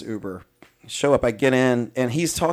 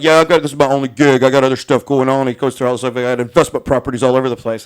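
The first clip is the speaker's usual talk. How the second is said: louder, boastful mock driver; mocking bragging driver; 9 dB louder, faster than usual. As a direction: puff it up like a braggart. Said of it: "he's like".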